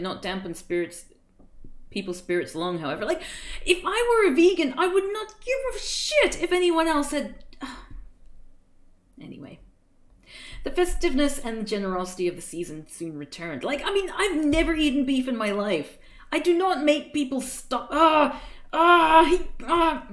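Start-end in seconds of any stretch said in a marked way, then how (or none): 3.0-7.4 loud, high indignant rant; exasperated outburst. Acted. Reader's outburst about vegans. 7.5-8.5 exasperated breathy sigh. Real, not acted. Gives up after outburst. 13.6-20.0 loud, high frustrated sputter; indignant sputtering. Acted. Reader's indignant outburst about vegans.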